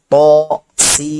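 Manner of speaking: Quranic letters drawn out very slowly; tajweed recitation with long madd. Shown as a demonstration. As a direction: chant each letter long and measured. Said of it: "तीन second तीन अलिफ तक खींचेंगे".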